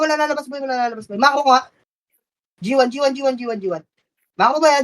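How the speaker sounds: ordinary talk, nothing marked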